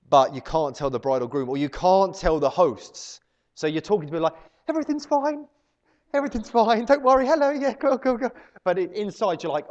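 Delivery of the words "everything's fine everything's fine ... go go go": high, sing-out voice of forced calm; fake cheerful reassurance. Helper hiding the chaos from the hosts, fake cheerfulness. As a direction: cover the panic, over-bright and high.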